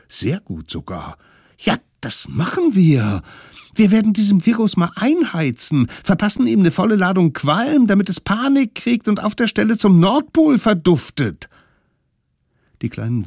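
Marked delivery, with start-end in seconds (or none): 1.6-11.5 hoch und laut, voller Tatendrang; begeistert. Acted. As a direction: wie aufgedrehte Kinder, die einen Plan schmieden. Kinder beschließen begeistert den Plan. (begeisterte Kinderstimme, hoch und laut)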